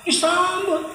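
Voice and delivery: high sing-song Disambut; high mock welcome. Shown as a demonstration. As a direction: repeat Disambut up high, impressed.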